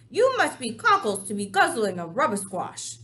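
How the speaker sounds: brutish giant. Acted. Giant mocks the BFG.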